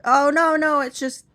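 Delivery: flustered brush-off; embarrassed denial. Retold or imitated. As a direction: cover the flinch with a quick excuse.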